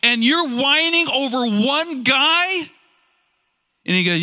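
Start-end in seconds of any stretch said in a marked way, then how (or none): 0.0-2.7 higher, incredulous manager voice, slowing on the last line; stunned manager, pitched well up, drawing out the final question; exasperated manager. Acted. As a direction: scoff at him for whining over one man. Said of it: "And his manager says".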